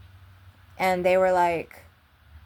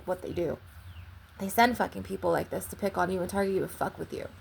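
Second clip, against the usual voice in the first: normal talking voice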